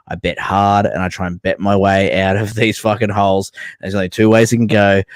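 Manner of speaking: ordinary talk, nothing marked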